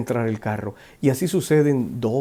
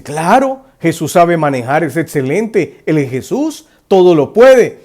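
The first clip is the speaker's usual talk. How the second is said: higher, louder confident reply; eager passenger; 4 semitones higher, 8 dB louder than usual. Retold or imitated. Driver agreeing Jesus can drive, eager and raised. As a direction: brush it off with cheerful certainty. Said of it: "Y usted dice".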